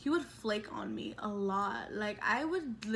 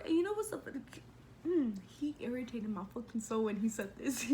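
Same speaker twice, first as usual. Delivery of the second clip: normal talking voice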